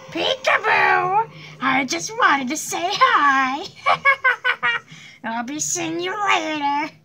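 slow high sing-song taunt with cackles; creepy playful villain. Acted. Villain popping out to greet the player, slow sing-song taunt. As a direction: tease him like a creepy toy.

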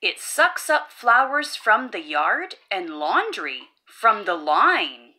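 usual speaking voice

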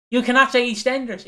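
mock indignant. Podcaster scolding wrestlers for their favourite Scottish thing, mock indignant. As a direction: protest it emphatically.

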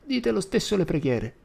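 put-on high, bossy old woman; high old woman's order; ordering grandma. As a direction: command like a stern granny. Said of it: "ordinò lei".

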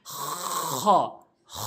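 খ with long rasping friction in the throat; throaty kha. Shown as a demonstration. Teacher modelling how we will pronounce it, rasped খ. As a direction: scrape খ out from the end of the throat. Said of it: "এভাবে আমরা উচ্চারণ করব".

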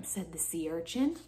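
usual speaking voice

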